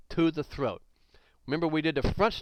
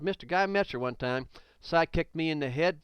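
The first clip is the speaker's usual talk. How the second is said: ordinary talk, nothing marked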